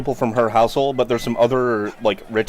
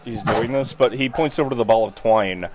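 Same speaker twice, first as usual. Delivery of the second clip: ordinary talk, nothing marked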